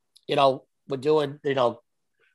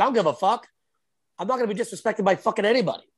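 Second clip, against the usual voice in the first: higher-pitched, fast retold outburst; retold defiance; 7 semitones higher, faster than usual. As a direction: reenact refusing to take any disrespect. Retold heated confrontation with housemate.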